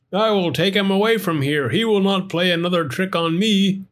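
fed-up and determined; cross hot dog man. Acted.

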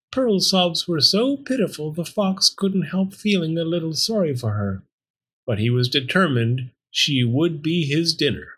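ordinary delivery, nothing marked

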